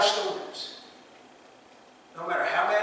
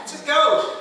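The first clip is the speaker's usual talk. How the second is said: mock panic; 10 semitones higher, 9 dB louder than usual. Disciples panicking at a ghost.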